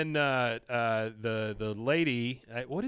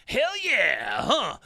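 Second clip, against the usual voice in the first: high, loud rocker whoop; [name]; 10 semitones higher, 5 dB louder, much slower than usual. Retold or imitated. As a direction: whoop it like a hyped-up rock star. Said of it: "this is [name]".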